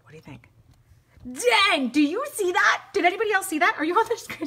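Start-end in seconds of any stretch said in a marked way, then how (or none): 1.7-4.5 higher and louder, bursting with glee; can't contain the thrill; real excitement at the stamp working; super excited. Real, not acted. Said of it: "Sorry, I'm super excited".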